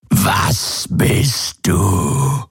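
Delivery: very slow, eerie drawn-out question; piercing telepathic voice. A narrator's reading. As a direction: stretch every word like an alien mind probing. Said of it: "gellte die telepathische Stimme durch seinen Kopf". (gedehnte, gellende Geisterstimme)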